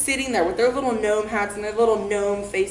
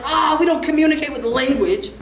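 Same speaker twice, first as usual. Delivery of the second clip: raised, hammed-up shout back; defiant yell; 4 semitones higher, 4 dB louder than usual. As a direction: holler back at the cop, mock-wild. Acted yell back at the cop.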